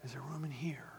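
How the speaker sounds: whispered question; hushed whisper, asking room. Real, not acted. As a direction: breathe it to the church. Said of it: "Come on, church".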